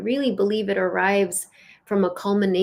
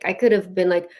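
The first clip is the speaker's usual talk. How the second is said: ordinary talk, nothing marked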